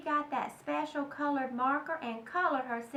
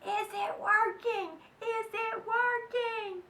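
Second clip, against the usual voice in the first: higher, slow sing-song calling; lilting, drawn-out call; mild yell; 7 semitones higher, slower than usual. Acted. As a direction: call through the bathroom door in a sing-song. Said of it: "stood outside the door and yelled".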